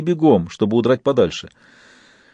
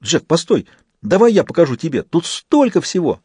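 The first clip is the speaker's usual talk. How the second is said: excited; 7 semitones higher than usual. A narrator's reading. Fist excited, pleading with Jack.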